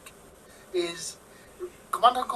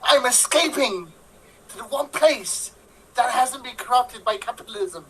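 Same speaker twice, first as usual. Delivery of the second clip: Tim Curry line recital; 2 semitones higher, slower than usual. Acted. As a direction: recite it grandly, building to a big SPACE. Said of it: "I know it by heart".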